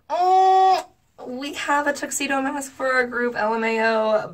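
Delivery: long high "oh", then politely high; awkward deflection. Retold or imitated. Deflecting his tuxedo mask suggestion, awkwardly high. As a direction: pitch it way up to dodge the suggestion.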